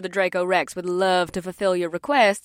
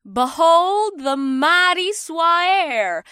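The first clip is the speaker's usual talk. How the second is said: grand announcement; 7 semitones higher, much slower than usual. Acted. Kandara presents the dragon.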